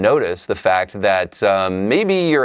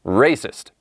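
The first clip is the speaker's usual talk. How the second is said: Southern accent. Shown as a demonstration. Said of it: "say this with a Southern accent".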